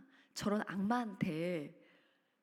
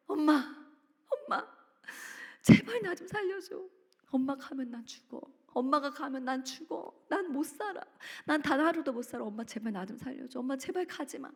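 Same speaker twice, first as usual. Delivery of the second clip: desperate pleading; 5 semitones higher than usual. Retold or imitated. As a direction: beg her not to leave, voice pitched up in panic. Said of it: "이렇게 엄마한테 애원을 한 거예요". (떠나려는 엄마에게 무릎 꿇고 울먹이며 애원하는 딸)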